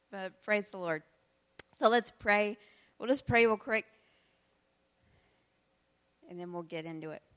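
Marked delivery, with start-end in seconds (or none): none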